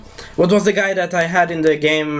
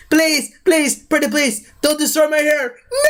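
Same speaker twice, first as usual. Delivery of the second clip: mock pleading; 9 semitones higher than usual. Thor begging Stan Lee to spare his hair, hammed-up pleading. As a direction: beg desperately in a squeaky high pitch.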